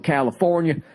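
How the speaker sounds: put-on Southern accent. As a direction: read the tour dates in a Southern accent. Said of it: "Why are you saying it with a Southern accent?".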